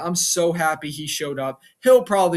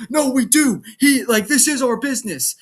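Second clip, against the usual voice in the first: higher, louder acted objection; mock heated protest; 8 semitones higher, 6 dB louder than usual. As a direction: argue back as the hotheaded brother. Acted argument between brothers.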